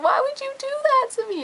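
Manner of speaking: squeaky high, betrayed whine; to her brother, hurt high whine; whiny protest. Retold or imitated. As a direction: plead way up high like a wronged kid. Confronting brother who blamed her.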